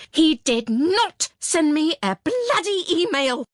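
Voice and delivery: furious outburst. Acted. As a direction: let it explode in the empty room. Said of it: "My voice rockets round the cubicles".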